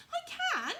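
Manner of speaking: very high, loud insistent toy rabbit; squeaky loud defiant little rabbit. Acted. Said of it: "said the little rabbit".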